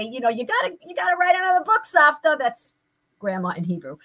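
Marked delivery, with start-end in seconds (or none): 0.3-2.4 quick, higher pleading grandchild; grandson's voice. Retold or imitated. Grandson urging his grandma to write a book, eager. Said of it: "And he'll say".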